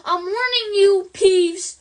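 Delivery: loud stern warning. A narrator's reading. McGonagall warning Peeves, loud and stern. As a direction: warn him, hard and loud. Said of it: "shouted Professor McGonagall".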